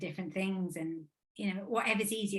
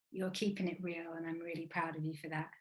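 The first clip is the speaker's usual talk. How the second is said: much softer, gently proud; soft nan voice; 3 semitones lower, 7 dB quieter than usual. Retold or imitated. Nan tells her granddaughter she's proud, much softer. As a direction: speak nan's pride softly, like a hush. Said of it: "But she's like".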